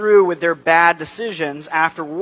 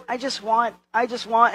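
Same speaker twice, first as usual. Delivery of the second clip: put-on higher, softer little-kid plea; imitating insistent toddler; 4 semitones higher, 4 dB quieter than usual. Three-year-old who keeps on wanting, mock childish plea. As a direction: plead like a small child. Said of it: "But he's always".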